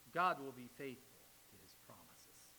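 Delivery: ordinary delivery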